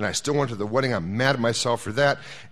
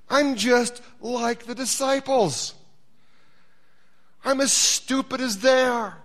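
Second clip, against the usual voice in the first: mock self-deprecation; 9 semitones higher, 3 dB louder, slower than usual. Real, not acted. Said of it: "self-mocking confession".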